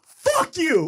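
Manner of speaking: shouted insult, high and loud; angry shout. Retold or imitated. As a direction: yell it at the winner. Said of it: "she looks at me and she goes".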